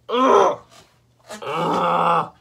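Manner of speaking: loud, exaggerated "ugh" of disgust; disgusted groan. Mocks Mary Jane with disgusted noises.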